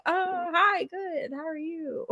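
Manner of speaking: imitating a customer thrown by a cheery greeting; taken aback. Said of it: "taken aback".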